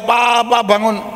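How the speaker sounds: calling out Papa in imitated grief; half-acted cry to wake him; retold cry for papa. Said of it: "papanya teriak".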